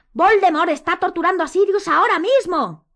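loud, high urgent shout. A narrator's reading. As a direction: shout it in alarm. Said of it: "gritó Harry".

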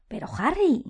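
high, thin-voiced start; thread of a voice. A narrator's reading. Ron starts objecting to Harry, in a thread of a voice. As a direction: begin timidly, pitched well up. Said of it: "empezó Ron con un hilo de voz".

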